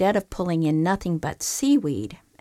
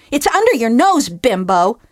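higher, louder tirade; ranting groundhog; 5 semitones higher, 8 dB louder than usual. Acted. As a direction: tear into the ungrateful bum. Groundhog's final jab.

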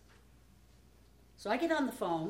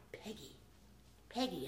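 very hushed, gentle call of a name; barely above a whisper, calling to get attention; a hushed little voice tugging at someone; soft child's call; 3 semitones lower, 14 dB quieter than usual. Retold or imitated. Little Jennifer calling softly.

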